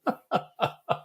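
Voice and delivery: helpless trailing laugh; real laughter. Real, not acted. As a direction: keep giggling at the punchline. Still laughing at own story.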